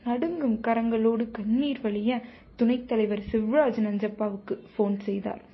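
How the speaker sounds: usual speaking voice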